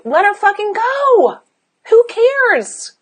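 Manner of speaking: high-pitched, emphatic brush-off; exasperated dismissal. Real, not acted. Coach urging client to drop a judging friend, exasperated.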